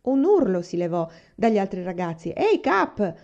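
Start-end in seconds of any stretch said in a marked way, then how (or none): 2.3-3.1 high-pitched mock yell, only a little louder; narrated shout. A narrator's reading. The boys protest to their friend Cap, shouting. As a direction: call out in protest, voice jumping up. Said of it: "si misero a gridare tutti insieme".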